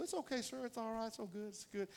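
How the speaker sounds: soft, high reassuring voice; nice young man. Retold or imitated. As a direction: imitate a polite kid saying no problem. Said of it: "this young man was so nice".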